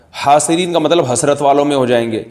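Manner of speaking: ordinary talk, nothing marked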